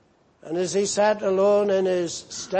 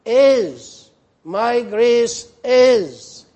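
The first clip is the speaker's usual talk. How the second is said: "Is" punched louder and higher, then "my grace is" slow; emphatic rebuke on "is"; stress on is; 4 semitones higher, 7 dB louder, much slower than usual. Retold or imitated. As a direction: hammer the word "is" as God's rebuke. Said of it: "and rebuked him".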